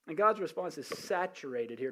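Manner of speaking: ordinary talk, nothing marked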